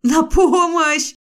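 high, loud, drawn-out scream; screaming for help. Acted. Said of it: "закричала я".

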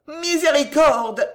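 high, loud, slow moan of terror; terrified moan for mercy; wailing. A narrator's reading. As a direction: cry for mercy. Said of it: "gémit Gillot".

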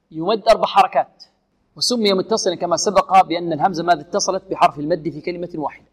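ordinary talk

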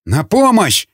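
high-pitched cry for help; full-throated yell. A narrator's reading. Prisoner calling guards against his interrogator, yelling. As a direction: call out urgently, pitch thrown up high. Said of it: "заорал во всё горло Николай".

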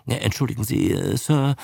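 plain reading voice

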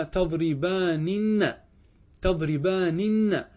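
"تضربانن" said very slowly, final نن held; teacher showing the underlying form slowly; slow spelled-out form. Shown as a demonstration. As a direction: stretch "تضربانن" to show the three nuns. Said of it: "أصلها إيش قلنا".

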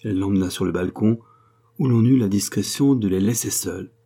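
plain reading voice

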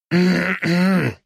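a short gruff throat clear. A narrator's reading. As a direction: clear the throat to get attention. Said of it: "кашлянул мужчина-зомби".

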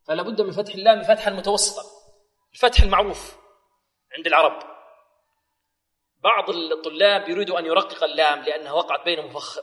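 normal talking voice

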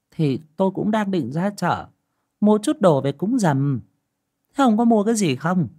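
wife Dương telling her husband she's off to market, high voice; old wife voice. Acted. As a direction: pitch up as the busy wife heading to market.

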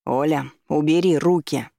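firm, mildly thundering order; thundered command. A narrator's reading. Driver pushing the woman away, thundering command. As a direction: order her off you. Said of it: "прогрохотал мужчина".

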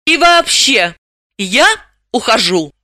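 high, emphatic announcement of leaving; huffy parting declaration; indignant protest. A narrator's reading. Dalila announces she is leaving.

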